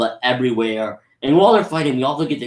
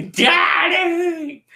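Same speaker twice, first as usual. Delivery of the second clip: high anguished mock wail; Broly crying Daddy. Broly grieving his father, hammed-up anguished cry. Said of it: "And Broly's like".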